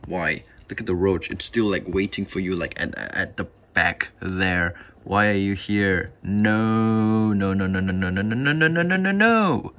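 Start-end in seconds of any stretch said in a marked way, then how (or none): 6.2-9.7 rising panic. Real, not acted. Spider escaping enclosure.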